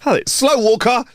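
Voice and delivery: high, slow hammed-up taunt; mock fighter taunt. Joking about kicking slow walkers, high mock taunt. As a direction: sneer it like a cartoon brawler.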